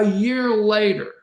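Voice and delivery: ordinary talk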